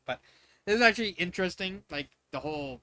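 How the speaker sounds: ordinary delivery, nothing marked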